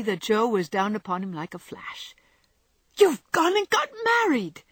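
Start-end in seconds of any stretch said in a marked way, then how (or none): 3.0-4.6 very high, loud outburst; shocked exclamation. Acted. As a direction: shriek it in astonished accusation. Jo pounces on Laurie's secret.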